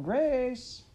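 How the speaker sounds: high, loud call across the yard. A narrator's reading. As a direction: call her name far away.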